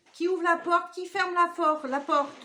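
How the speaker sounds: loud, high call through the house. Real, not acted. To her household about the open door, loud high call. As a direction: call out who opened the door.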